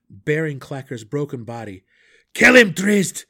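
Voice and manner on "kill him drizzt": high, loud cry; shouting. Acted. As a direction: yell it across the room. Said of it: "the Borough Warden cried".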